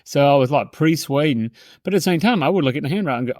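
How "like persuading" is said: ordinary talk, nothing marked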